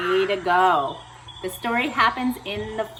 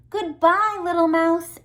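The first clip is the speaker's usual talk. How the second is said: higher, louder hurried farewell; hasty fox; 9 semitones higher, 6 dB louder than usual. Acted. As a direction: rush off in fright. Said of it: "away he sped".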